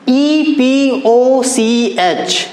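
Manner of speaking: letters spelled out one by one, very slow; teacher spelling each letter with long gaps. Shown as a demonstration. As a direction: spell it slowly for the class. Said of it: "इसका जो pronunciation".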